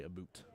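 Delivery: "about" pronounced "a boot"; mock Canadian "about". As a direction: say "about" with an oo vowel, imitating Canadians. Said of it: "we'll be like, we wanna say".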